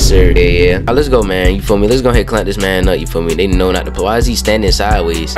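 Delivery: ordinary talk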